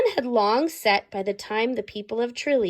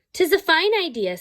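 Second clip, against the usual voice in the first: Irish brogue; 7 semitones higher, 4 dB louder than usual. Acted. Townsfolk voiced in Irish story.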